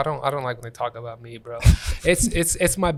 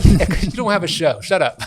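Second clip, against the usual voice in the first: mock retort; 4 semitones higher, 10 dB louder than usual. Snapping back at newcomer comment.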